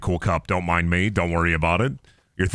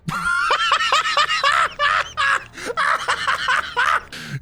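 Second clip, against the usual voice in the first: cackling shout; shouted laugh. Real, not acted. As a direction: howl with laughter after the scare. Big laugh after scare.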